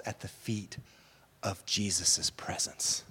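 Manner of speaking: soft unvoiced whisper; hushed sermon whisper. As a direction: breathe the words reverently. Whispered climax.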